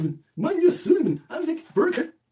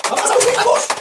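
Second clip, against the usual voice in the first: shouting; 10 semitones higher, 8 dB louder, faster than usual. Real, not acted. Urging someone to open mouth.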